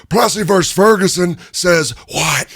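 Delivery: high, shouted Stone Cold promo; wrestling promo voice. Retold or imitated. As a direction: argue the case like cutting a wrestling promo. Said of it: "like he's cutting a promo".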